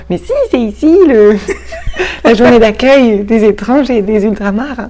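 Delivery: high, laughing Breton character voice; insisting Séraphine. Retold or imitated. Séraphine insisting to the organizer, laughing high Breton accent. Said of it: "j'ai insisté en disant".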